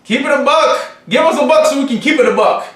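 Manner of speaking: high, loud, rapid hype; hammed-up high-pitched sales holler; hollered catchphrase. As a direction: shout the slogan at the viewers. Shouted catchphrase in donation plea.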